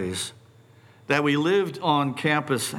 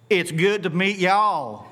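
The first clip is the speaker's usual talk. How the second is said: put-on Southern accent; 5 semitones higher than usual. Two young girls greet their new campus neighbors, imitated Southern accent. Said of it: "they spoke with perfect Southern accents".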